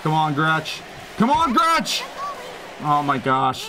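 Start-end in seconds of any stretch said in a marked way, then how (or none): 1.2-2.0 higher, urgent call to the player; excited cheer. Real, not acted. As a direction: yell it up at the screen. Cheering teammate, louder repeat.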